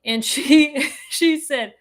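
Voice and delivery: ordinary delivery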